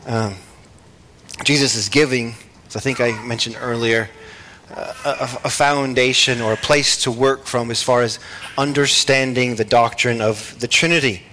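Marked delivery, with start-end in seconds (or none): none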